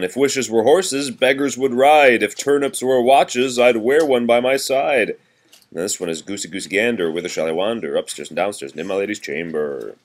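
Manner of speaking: lively sing-song nursery rhyme reading; reading to children. Acted. Parent reading seasons rhyme to a toddler, sing-song. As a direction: read it bouncy for a small child.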